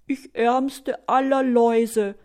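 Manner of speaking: slow, drawn-out lament; hammed-up poor-me louse; sighing self-pity. A narrator's reading. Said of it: "seufzt die Laus".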